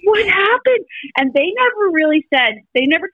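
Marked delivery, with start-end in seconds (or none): 0.0-0.8 high, teary, touched cry; tearful amazement. Retold or imitated. Mom moved as sons reconcile, high teary cry. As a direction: voice breaking with emotion. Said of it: "And I was like".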